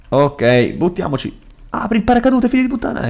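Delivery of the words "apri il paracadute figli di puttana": strillo acuto e concitato; urgenza concitata. Real, not acted. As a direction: incita con voce molto acuta durante il lancio. Si lancia, grida al personaggio. (urlo acuto di urgenza)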